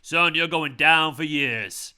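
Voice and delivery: slow, loud, hammed-up beat copper; mock policeman drawing out his words; old-fashioned cop. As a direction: lay down the sentence like a gruff old cop. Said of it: "old-fashioned cop who's on the beat".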